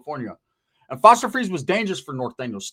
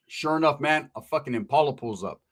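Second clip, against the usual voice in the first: ordinary delivery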